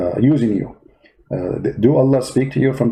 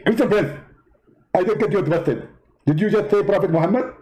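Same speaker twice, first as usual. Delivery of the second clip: high, indignant preacher voice; Zakir Naik impression; 5 semitones higher than usual. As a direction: play the offended debater, pitched well up. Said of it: "Zakir Naik?".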